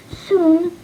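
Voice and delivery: ordinary delivery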